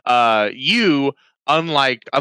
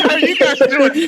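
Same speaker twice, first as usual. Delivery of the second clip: laughing imitation; 11 semitones higher, 4 dB louder than usual. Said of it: "That one got me good".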